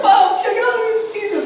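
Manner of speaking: very high squeal of mock excitement; excited fan. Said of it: "Bob's all excited".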